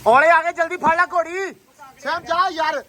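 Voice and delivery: urgent call. Real, not acted. Hail starts, calling out.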